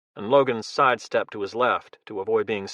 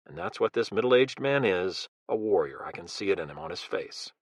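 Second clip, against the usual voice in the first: inner thought; 5 semitones lower, 6 dB quieter than usual. Acted.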